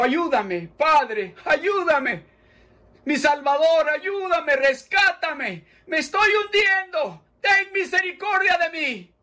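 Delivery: high, loud pleading prayer; fervent prayer. Acted. Preacher leading listeners in a fervent repeated prayer. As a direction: pray it out for them to repeat.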